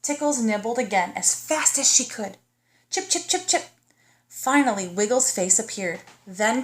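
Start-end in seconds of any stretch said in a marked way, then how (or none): 2.9-3.7 nibbling sound. Acted.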